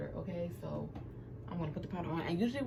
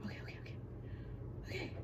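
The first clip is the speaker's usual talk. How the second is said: barely voiced, quick repeated whisper; nervous self-soothing; 10 semitones higher, 12 dB quieter, much faster than usual. Real, not acted. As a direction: whisper it to yourself to steady your nerves. Psyching herself up while scared.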